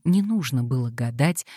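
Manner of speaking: plain reading voice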